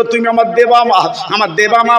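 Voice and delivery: ordinary delivery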